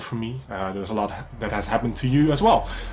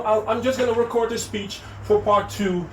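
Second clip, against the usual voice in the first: normal talking voice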